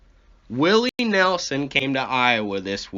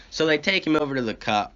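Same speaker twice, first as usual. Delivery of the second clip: usual speaking voice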